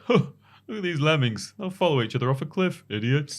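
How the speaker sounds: high, scornful put-on voice; mocking filmmaker voice. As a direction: sneer at the lemmings. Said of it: "they said".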